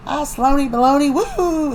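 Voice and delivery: playful teasing whoop. Retold or imitated. Nicole kicking her leg up, high teasing coo and whoop. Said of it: "Nicole's like".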